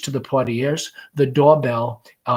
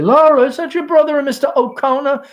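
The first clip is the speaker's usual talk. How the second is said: much higher, bright and sing-song; very gaily; 12 semitones higher, 7 dB louder than usual. Acted. Mother calls gaily so the guests hear. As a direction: put on a cheerful front for guests. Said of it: "very gaily".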